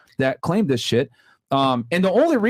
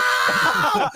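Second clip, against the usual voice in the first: high shrieking laugh. Real, not acted. Laugh after imitated scream.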